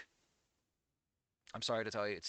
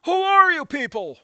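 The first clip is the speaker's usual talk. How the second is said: higher and louder in a put-on voice, stress on are; hammed-up exasperated yell; mock outraged shout; 12 semitones higher, 10 dB louder than usual. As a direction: bellow it like a cartoon meltdown. Repeats meme outburst.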